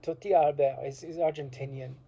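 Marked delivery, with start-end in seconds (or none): 0.0-0.8 Tortilla Albert in a light French accent, Albert as al-BEHR; touch of French accent on the name. Shown as a demonstration. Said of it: "he, uh, said in a French accent".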